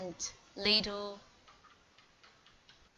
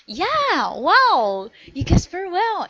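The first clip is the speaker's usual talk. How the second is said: bright, much higher and louder praise; excited praise; 9 semitones higher, 11 dB louder than usual. Real, not acted. Teacher praising child's correct guess.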